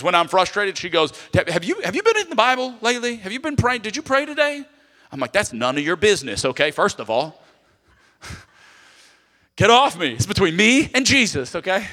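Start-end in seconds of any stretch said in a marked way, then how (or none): 1.3-4.7 high, pointed questioning as his wife; nagging wife. Retold or imitated. Wife quizzing her frustrated husband, high and pointed. 5.3-11.9 loud, huffy brush-off; defensive. Retold or imitated. Husband snapping back at his wife, loud and huffy.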